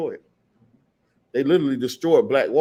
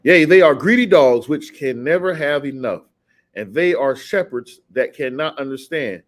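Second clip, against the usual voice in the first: slow, emphatic scripture reading; measured, forceful preacher reading aloud; 6 dB louder, slower than usual. Real, not acted. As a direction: proclaim the verse loudly and deliberately.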